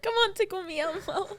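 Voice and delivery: high quote through laughter; laughing quote. Retold or imitated. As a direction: repeat it cracking up. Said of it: "That was the funniest thing".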